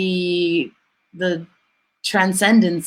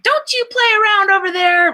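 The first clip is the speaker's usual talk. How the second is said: high, mock-scolding parent voice, pitched up; scolding American parent; 12 semitones higher, 5 dB louder than usual. Comedian mimics a parent scolding kids, high mock scold. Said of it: "She's like".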